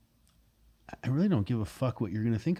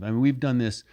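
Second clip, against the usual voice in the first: ordinary talk, nothing marked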